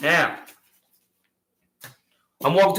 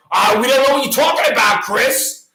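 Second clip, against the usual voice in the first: mocking official; 6 semitones higher than usual. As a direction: mimic a smug official brushing him off. Mocking officials' denial at him.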